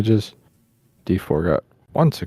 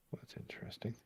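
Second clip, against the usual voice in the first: whispered aside; whispering; 26 dB quieter than usual. Real, not acted. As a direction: breathe it to yourself. Player muttering to self.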